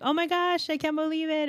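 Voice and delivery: high, bright-eyed gasp of disbelief, mock thrilled; retold delight. Retold thrill at getting card.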